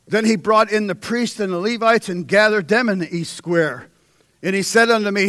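loud, high, ringing sermon delivery; full-force preaching. Acted. Preacher reading Hezekiah's story to congregation, preaching loud. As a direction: preach it to the back row.

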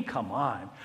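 ordinary talk, nothing marked

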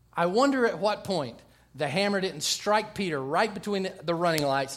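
ordinary talk, nothing marked

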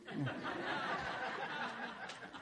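high airy laugh at his own punchline. Real, not acted. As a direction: burst of real laughter.

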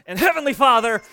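mock strained prayer, suddenly loud; pained prayer outburst. Dad praying as his back gets wrenched, mock pained outburst. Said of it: "did one of those".

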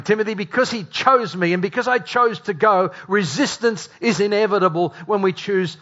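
high, loud, driving sermon voice; fiery preaching. Acted. Pastor urging the congregation on, loud, impassioned preaching.

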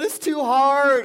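put-on high, slow, loud child's whine; whiny kid voice. Son sobbing to dad about the litter, imitated whine. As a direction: whine it out like an upset little boy.

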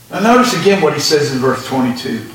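usual speaking voice

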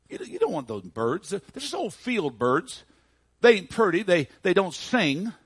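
high-pitched, halting protest; dumbfounded boy. Retold or imitated. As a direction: stammer it like a baffled lad. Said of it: "was just kind of dumbfounded".

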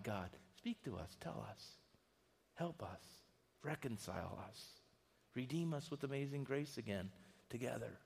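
soft, pleading prayer, barely above a murmur; hushed prayer. Acted. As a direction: pray it quietly for the whole family.

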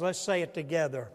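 ordinary delivery

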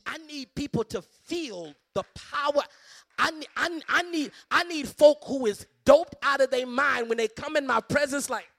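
impassioned shout, stammering into it; shouted preaching. Real, not acted. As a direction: build into a full-force preacher's shout. Preacher bursts into full-force preaching.

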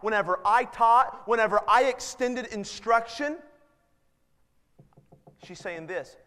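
usual speaking voice

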